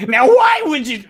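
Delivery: sudden high, loud squawk of a question; a quick stab at the comedian's screech; Gilbert Gottfried impression. After the Gottfried joke, blurting a high, loud squawk. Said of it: "like, uh, Gilbert Gottfried".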